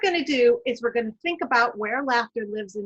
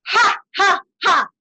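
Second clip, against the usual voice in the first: loud ha ha ha laugh; 8 semitones higher, 10 dB louder than usual. Shown as a demonstration. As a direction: bark out three open laughs. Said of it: "Breathe in".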